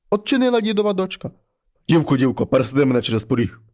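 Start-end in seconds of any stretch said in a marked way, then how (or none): 1.9-3.6 low, heavy voice of a wolf head at the door; low monster head voice. Acted. Wolf head knocks and calls the girl, low and heavy. Bear head demands lifting over.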